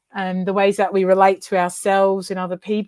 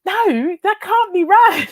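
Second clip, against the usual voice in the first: incredulous protest; 11 semitones higher, 6 dB louder, slower than usual. Retold or imitated. Retold disbelief during the argument.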